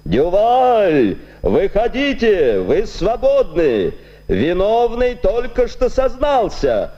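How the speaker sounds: high, slow fairground barker boom; blustery pompous voice. A narrator's reading. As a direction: proclaim it like a fair barker. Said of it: "страшный, напыщенный голос".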